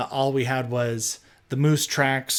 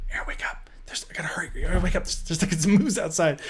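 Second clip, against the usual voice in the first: voiceless, much softer urgent whisper; 2 semitones higher, 4 dB quieter, faster than usual. Retold or imitated. Waking Aaron in the tent with a moose outside, urgent whisper. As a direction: wake him without the moose hearing.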